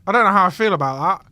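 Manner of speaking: ordinary talk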